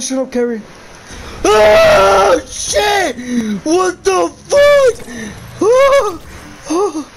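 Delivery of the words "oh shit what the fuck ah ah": high, loud panicked shouts; frightened yelling. Real, not acted. Streamer jumped again by the monster, loud fright. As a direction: yell in real fright. Second jump scare.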